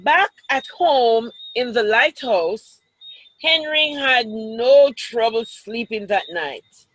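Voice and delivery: lively picture-book read-aloud; children's story voice. Acted. Teacher reads picture book to class.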